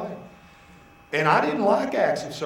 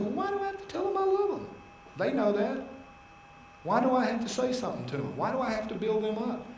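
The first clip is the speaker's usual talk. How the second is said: whiny protest; 8 semitones higher, 4 dB quieter than usual. Retold or imitated. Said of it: "And I said".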